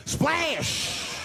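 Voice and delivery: high mock splash; splash sound. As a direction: shout the splash. Water thrown in face.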